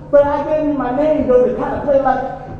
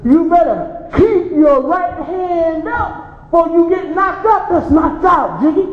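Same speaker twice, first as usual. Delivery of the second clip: put-on drunk taunting father; 5 semitones higher than usual. Drunk father taunting boxer son.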